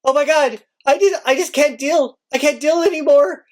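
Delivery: rising, high despairing cry; a teacher losing it, mock distraught. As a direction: come apart in front of the class. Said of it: "I was just standing there like".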